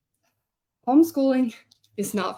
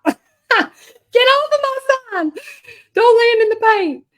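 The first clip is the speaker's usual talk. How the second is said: much higher, louder squeaky scolding; shooing the fly; 13 semitones higher, 10 dB louder than usual. Real, not acted. Crafter scolding a fly landing in her paint, squeaky high shout. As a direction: shoo the fly off your sign, high and sharp.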